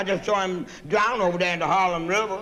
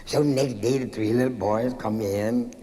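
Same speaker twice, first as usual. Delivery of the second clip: ordinary delivery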